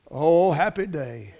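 ordinary delivery

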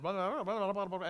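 rapid run-on blah-blah; mock tirade gabble. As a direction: gabble it to skim over her rant. Imitating the woman's tirade.

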